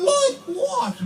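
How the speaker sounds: high, squeaky disbelieving "Like what?"; incredulous question. Retold or imitated. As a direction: jump way up in disbelief. Comedian mocks phone salesman exchange.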